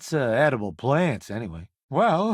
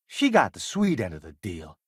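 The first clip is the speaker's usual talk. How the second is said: usual speaking voice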